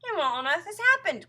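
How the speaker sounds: high, loud wail of bafflement; bewildered cry. A narrator's reading. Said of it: "cried Missus Twit".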